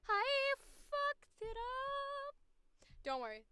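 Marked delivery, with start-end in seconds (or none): 0.1-2.3 very high, slow, stretched-out voice; put-on character voice. Shown as a demonstration. Voice actor testing a character line, high and slow. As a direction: drag it out way up high. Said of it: "That's an easy voice".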